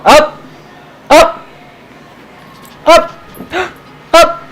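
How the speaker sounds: high, loud pet command. Real, not acted. Owner tells her puppy up in a high, loud voice. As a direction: chirp the word up at him.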